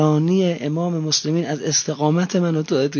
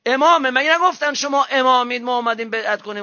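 higher rebuking sermon voice; reproachful preaching; 8 semitones higher than usual. Real, not acted. Preacher rebuking the Kufans, higher and reproachful. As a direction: scold the faithless from the pulpit.

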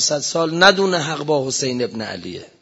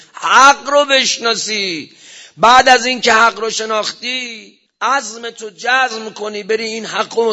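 preaching at full force; 5 semitones higher, 6 dB louder than usual. Real, not acted. Preacher crying out to the congregation, loud and high. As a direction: hammer it home to the congregation.

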